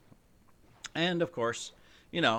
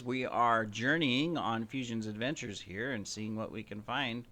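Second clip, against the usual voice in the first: normal talking voice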